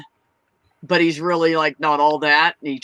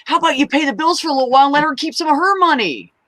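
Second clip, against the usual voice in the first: higher, fast lecturing of a freeloader; scolding retort; 7 semitones higher than usual. Retold or imitated. Telling the brother to pay the bills, high and fast. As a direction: rattle off the fix, pitched up and indignant. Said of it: "And I'm like".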